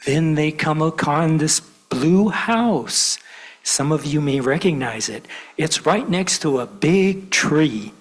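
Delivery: put-on lively read-aloud for kids at church; storytelling to children. A narrator's reading. Minister reads to gathered children, animated storytelling. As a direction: tell it like a picture book.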